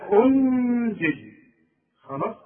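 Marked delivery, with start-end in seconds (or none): 0.1-1.3 أنزل with the نون nasalised and held long, high and loud; nasal ghunna, drawn out. Shown as a demonstration. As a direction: hold the ghunna on أنزل slowly. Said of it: "الغنة النون". (غنة مرققة في أنزل بصوت عالٍ وبطيء)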